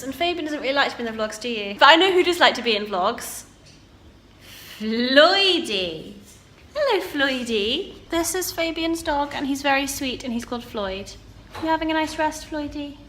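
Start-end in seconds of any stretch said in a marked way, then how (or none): 4.8-13.0 high, slow pet-greeting voice; affectionate, raised-pitch doggy talk. Real, not acted. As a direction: coo at the dog in a singsong lilt. Cooing greeting to a friend's dog.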